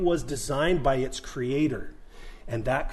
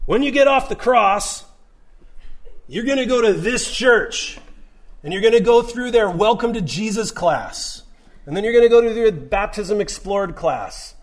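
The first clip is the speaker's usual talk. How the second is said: higher, louder, mock bureaucratic checklist; mock church process; 6 semitones higher, 6 dB louder than usual. Jesus parodied listing church classes, sarcastic and raised. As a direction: rattle off church steps sarcastically. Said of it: "what did Jesus say to him".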